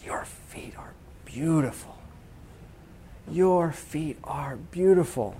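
slow, reverent near-whisper; hushed passion. Retold or imitated. Friend praises the missionaries' bare feet, in a slow impassioned hush. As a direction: breathe each word slowly, imitating a fervent friend. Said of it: "he had such passion".

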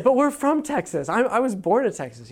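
put-on high, earnest child protest; protesting little boy. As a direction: insist like an indignant six-year-old. Young self protesting to dad.

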